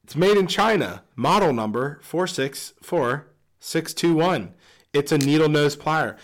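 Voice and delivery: hammed-up commercial announcer; ad read. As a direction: sell the pliers like a TV ad.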